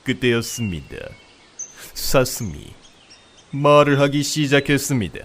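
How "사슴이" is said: ordinary delivery, nothing marked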